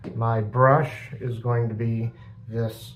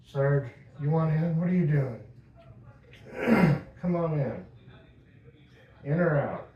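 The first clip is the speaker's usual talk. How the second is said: higher, gentle pet-talk voice; talking to the cat; 5 semitones higher than usual. Real, not acted.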